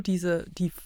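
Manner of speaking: ordinary talk, nothing marked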